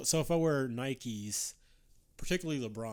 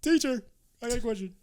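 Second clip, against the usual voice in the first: high, eager student calling out to teacher; eager schoolboy asking teacher; schoolkid voice; 10 semitones higher, 5 dB louder than usual. Retold or imitated. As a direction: pipe up like a kid raising his hand. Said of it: "hand up like he in school".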